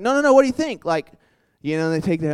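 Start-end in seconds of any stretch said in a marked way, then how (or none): 0.0-0.8 high, louder eager pestering; mock pushy insistence. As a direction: press them like an overeager evangelist. Pushy student pressing someone.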